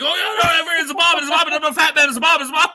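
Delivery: high, loud frantic babbling; Homer Simpson. Retold or imitated. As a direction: yell it in a cartoon panic. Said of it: "He was like Homer Simpson".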